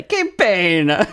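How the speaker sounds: punchy, savored outburst; gleeful exclamation. Shown as a demonstration. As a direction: relish it like a favorite catchphrase. Favorite thing to say, exclaimed.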